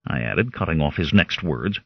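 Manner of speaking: plain reading voice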